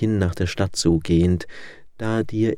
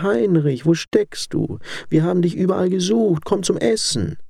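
put-on old woman calling; 10 semitones higher than usual. A narrator's reading. Old mother searching for her son calls him to eat. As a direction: call him in for dinner, anxious. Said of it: "rief eine alte Frau".

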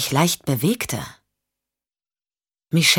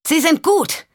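much higher, called out over noise; calling over engines; 12 semitones higher than usual. Acted. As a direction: shout it above the roar. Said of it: "rief Michelle über den Lärm".

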